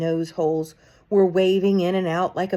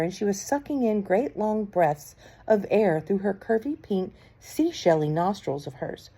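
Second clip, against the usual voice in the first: ordinary delivery, nothing marked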